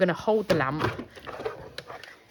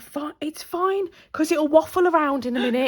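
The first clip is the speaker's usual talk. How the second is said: imitating her breezy reassurance, pitched up; mock girlfriend; 8 semitones higher than usual. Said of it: "she was like".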